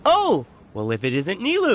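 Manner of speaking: light up at seeing a friend; surprised. Acted. Merchant spots Nilou arriving.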